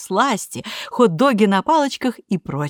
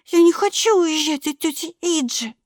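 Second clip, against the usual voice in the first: put-on tearful little girl; 11 semitones higher than usual. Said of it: "потом расплакалась".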